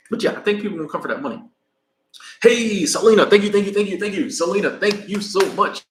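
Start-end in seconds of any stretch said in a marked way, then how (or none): 2.4-5.8 loud, high gushing thank-yous; excited thanks. Real, not acted. Vlogger thanking Selena for a Super Chat, loud and excited. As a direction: cheer the donor on.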